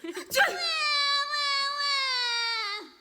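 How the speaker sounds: shrieking cackle, high and loud; wild cackle. Acted. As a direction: let out a crazed cackle. Cook's wild laugh.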